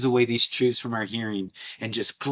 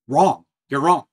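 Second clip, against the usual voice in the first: firm rebuke; 3 semitones higher, 6 dB louder than usual. Real, not acted. Preacher rebuking.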